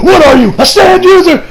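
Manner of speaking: loud, high confrontational question; imitated heated challenge; aggressive demand. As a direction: demand it as if grabbing someone. Grabs her, demands answer.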